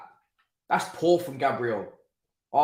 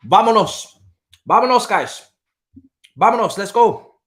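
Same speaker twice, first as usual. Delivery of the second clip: loud, higher call to the team; rallying cheer; 8 semitones higher, 8 dB louder than usual. Real, not acted. Cheering his team after goal.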